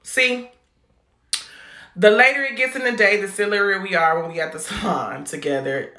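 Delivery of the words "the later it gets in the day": ordinary talk, nothing marked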